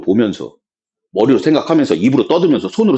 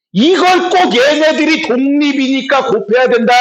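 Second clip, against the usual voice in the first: mock questioning; 9 semitones higher than usual. Teacher mocking a rule to students, mock questioning. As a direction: voice a silly rule as an incredulous question.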